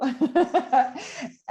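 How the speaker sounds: high, bright burst of laughter; a real delighted laugh; amused laugh. Real, not acted. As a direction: laugh at how casual they were. Laughing at kids' casual reaction.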